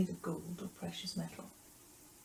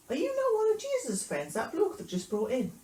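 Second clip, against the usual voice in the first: put-on high, loud girl's challenge; inquisitive girl; 9 semitones higher, 5 dB louder than usual. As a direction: pipe up with a nosy accusation. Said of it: "a girl came up to him and said".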